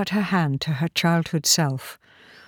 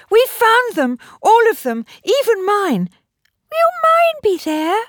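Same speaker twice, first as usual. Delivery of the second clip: put-on high, eager child voice; excited little girl; 6 dB louder than usual. A narrator's reading. Child tells the doctor about the found toys, excited. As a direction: pipe up high and loud like a small child.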